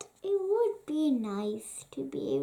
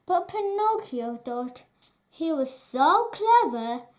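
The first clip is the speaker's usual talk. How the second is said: ordinary delivery, nothing marked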